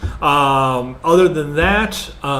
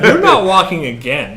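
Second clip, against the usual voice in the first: a laughing joke, louder; 4 semitones higher, 6 dB louder than usual. Real, not acted. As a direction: laugh through the punchline. Joking about movie injuries.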